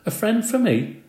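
squeaky high surprised question. A narrator's reading. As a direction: caught off guard by the news. Hamster surprised by news.

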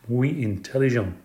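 plain reading voice

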